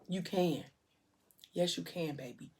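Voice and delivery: soft, tender reassurance; gentle encouragement. Retold or imitated. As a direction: quietly coax her through it. Partner arrives, encourages labouring mother.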